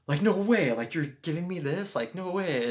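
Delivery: gasping, high-pitched imitation of delight; thrilled disbelief. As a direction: act out someone lit up by a gift. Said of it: "And she, like, lit up".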